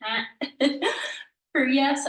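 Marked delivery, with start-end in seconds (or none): 0.0-0.2 short "heh" sounded through the nose; nasalized "heh". Shown as a demonstration. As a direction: say "heh" nasally, as a guy's yes. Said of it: "like a nasalized "heh".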